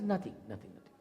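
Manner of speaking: put-on a hungry boy too shy to accept; low, quiet nothing, nothing; meek refusal. Hungry guest politely refusing food.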